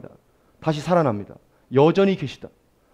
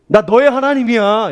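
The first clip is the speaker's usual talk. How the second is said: higher and louder, declaring; voice of God; 8 semitones higher, 8 dB louder than usual. Acted. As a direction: speak as God claiming his child. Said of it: "이런 말이에요".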